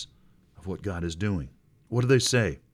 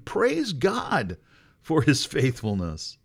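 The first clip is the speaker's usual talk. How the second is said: much higher exultant praise shout; Pentecostal praise; 6 semitones higher, 4 dB louder, slower than usual. Pretend. Preacher leading the clapping congregation, exultant praise. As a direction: lift it up like a revival. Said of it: "pretend that you're Pentecostal".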